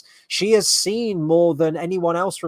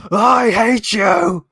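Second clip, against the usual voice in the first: slow hammed-up dramatic shout, higher and louder; over-the-top villain cry at her sister; mock hateful outburst; 6 semitones higher, 6 dB louder, slower than usual. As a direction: ham up a villain's betrayed rage. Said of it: "It looks like she's about to go".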